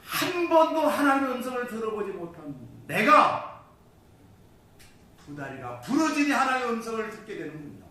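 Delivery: high, loud cry of amazement to God; acted-out awestruck prayer; overwhelmed thankful prayer. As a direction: cry out in grateful wonder. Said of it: "하나님 앞에 무릎을 꿇고 감사 기도했습니다".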